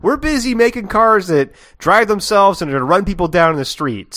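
loud, high blustering; indignant Aced. Pretend. Teased man brags about his car business, mock-indignant.

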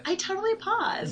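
put-on high valley girl lilt. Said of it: "sounding like a Valley Girl".

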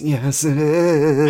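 very slow, drawn-out put-on voice; mock creepy insistence. [name] contradicting Ashley, drawn-out mock creepy. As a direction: stretch every word out eerily.

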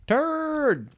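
imitating mom calling her son; mother's turd. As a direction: holler turd like his mother. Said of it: "her way like turd".

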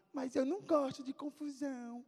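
mock tearful child, high-pitched; Halley crying. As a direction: whine it timidly through pretend tears. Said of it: "encontrei Halley chorando".

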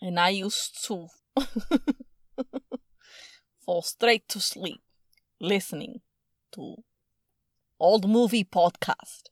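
careful put-on Russian accent. Shown as a demonstration. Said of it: "Be Russian".

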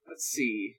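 ordinary delivery

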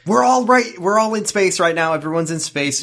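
high, loud, hurried covering-up; flustered blurting. Retold or imitated. Companion covering a slip about space, flustered and high.

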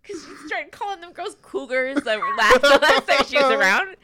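ordinary talk

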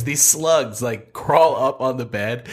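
ordinary talk

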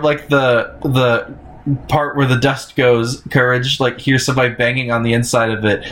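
normal talking voice

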